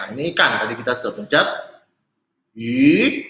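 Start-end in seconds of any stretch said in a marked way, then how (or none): 2.6-3.2 "I" sweeping up from mid to high; nada dua. Shown as a demonstration. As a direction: stretch "I" as it climbs. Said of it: "Ada dua". ("I" nada dua, naik dari tengah ke tinggi)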